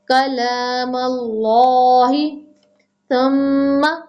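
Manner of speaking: slow melodic tajweed chant; Quran recitation. Acted. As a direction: recite slowly with held vowels.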